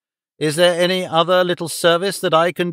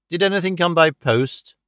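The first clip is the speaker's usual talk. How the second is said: ordinary delivery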